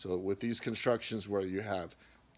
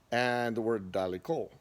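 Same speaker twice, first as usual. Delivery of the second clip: ordinary talk, nothing marked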